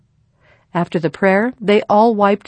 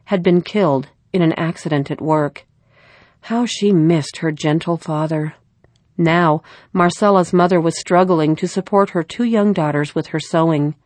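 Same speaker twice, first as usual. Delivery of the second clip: plain reading voice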